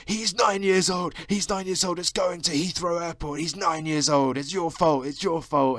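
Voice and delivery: high, loud, slow frantic yell; retold meltdown at a railway worker, screaming; furious screaming. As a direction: scream blame at the employee. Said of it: "screaming at this employee".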